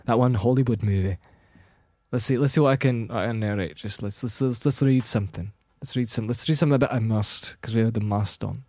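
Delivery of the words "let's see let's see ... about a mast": normal talking voice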